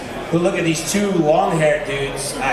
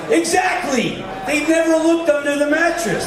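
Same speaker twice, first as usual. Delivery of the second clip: triumphant exclaim; 10 semitones higher than usual. Retold or imitated. Cliff gloating to his friend about the cops, triumphant shout. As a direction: crow it like you just outsmarted the cops.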